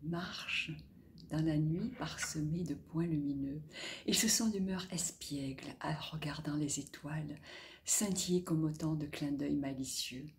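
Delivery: soft, near-whispered narration; hushed bedtime reading; hushed storytelling. A narrator's reading. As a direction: tell it like a secret at bedtime.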